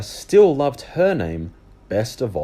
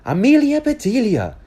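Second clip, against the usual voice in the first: higher, louder calling out; stern teacher call; 14 semitones higher, 7 dB louder than usual. Acted. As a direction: call a restless pupil to order. Teacher scolds Amelia.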